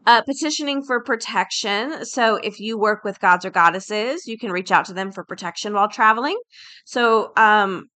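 ordinary talk

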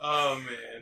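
normal talking voice

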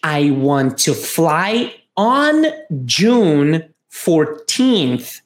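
slow, careful dialogue read; slow model phrase. Shown as a demonstration. Teacher reading the dialogue line slowly for students. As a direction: spell out each word slowly for learners. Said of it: "o A diz o seguinte".

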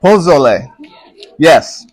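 Pozole said in a German accent. Shown as a demonstration. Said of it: "my German accent's coming through".

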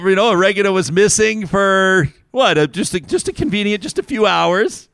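pushed high, mock-suspicious; mock insinuation. Mock conspiracy theory, pitched up.

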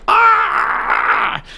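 a lighter high pained cry; mock scream. Joke teller imitating a second tortured soul: mock pained cry. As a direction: cry out in mock pain, not full force. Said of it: "she hears someone else screaming".

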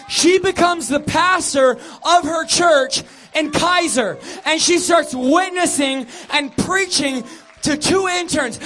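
loud, high, driving church exhortation; full-force preaching. Acted. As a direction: rouse the crowd to clap like in church. Preaching climax.

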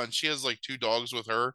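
usual speaking voice

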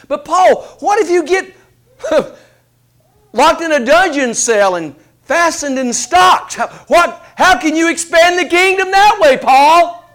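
high, loud, disbelieving challenge; incredulous objector. Acted. Skeptic challenging the apostle Paul, incredulous and loud. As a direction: push back on Paul like a doubting heckler.

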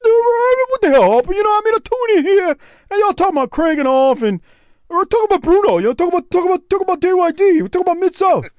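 very high, worked-up mimicry; mock exasperation, stammering and high; indignant guest impression. Mimics guest complaining to Harper.

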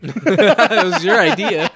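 slow words through laughter; laughing. Real, not acted. Friend reacting, laughing slowly.

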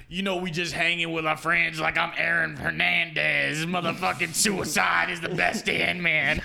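loud, slow hammed-up rap boast; mock rapper voice. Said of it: "That'd be like being like".

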